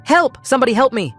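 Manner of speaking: very high, loud scream; screaming for help. Acted. Mark locked in bathroom, screaming for help. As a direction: scream for anyone to hear. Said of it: "I screamed".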